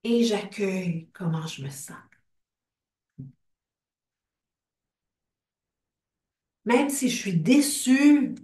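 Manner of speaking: guided tapping script. Acted. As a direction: lead the group through each feeling, line by line. Leads an EFT tapping script.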